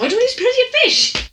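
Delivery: 1 high awkward squeak. Retold or imitated. Awkward laughing reply.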